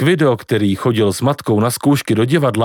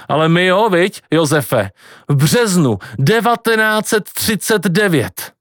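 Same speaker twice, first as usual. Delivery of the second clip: pub woman; 6 semitones higher than usual. A narrator's reading. Pub woman recalls 1939.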